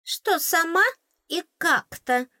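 high fairy mimicry stressing сама and как-то; high teasing imitation; mocking mimic. A narrator's reading. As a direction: parrot her words back to tease. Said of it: "передразнила, выделив тоном".

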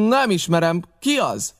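captain shouting back at the pirates; shouted back. A narrator's reading. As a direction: sneer it across the yard. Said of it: "kiáltotta vissza a kapitány".

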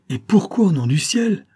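high, loud cry of alarm; shocked outcry. A narrator's reading. Lover cries out at Edith's request for poison, shocked outcry. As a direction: burst out, appalled. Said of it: "m'écriai-je".

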